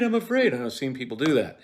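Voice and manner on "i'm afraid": high, loud, mock-scared "afraid" falling away; overplayed fright, falling on "afraid"; hammed-up fear. Shown as a demonstration. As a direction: repeat "I'm afraid" with a dropping overdone wail. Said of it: "the worst thing you can do playing Macbeth is go".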